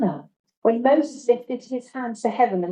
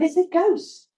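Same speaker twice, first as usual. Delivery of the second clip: mock fearful cry; 9 semitones higher than usual. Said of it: "cried out in fear".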